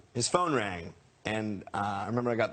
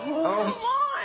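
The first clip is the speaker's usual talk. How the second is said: very high, overwhelmed whimper; acting out a starstruck sob; mock tearful; 3 dB louder than usual. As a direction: let it out as a tiny high wail. Acts out being moved to tears.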